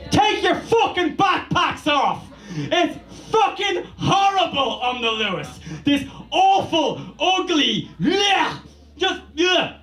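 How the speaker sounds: high, shouted complaint; angry rant. Real, not acted. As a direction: shout it in real irritation. Angry rant about backpacks.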